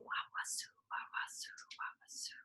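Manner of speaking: whispered hiss of a slithering python; hissing snake. Acted. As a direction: breathe it like a snake gliding past.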